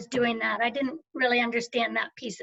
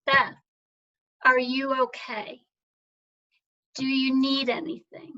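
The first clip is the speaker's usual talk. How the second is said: a friend's kind words, barely held together; moved to tears. Real, not acted. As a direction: let the tears come through as you quote them. Friends checking in, tough year.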